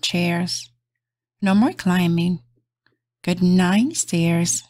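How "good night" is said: ordinary delivery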